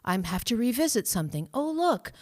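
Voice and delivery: bright bursts up high on each "Oh, look"; cheerful self-talk. Retold or imitated. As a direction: greet each old wound like a pleasant surprise. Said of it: "Beautiful compassion".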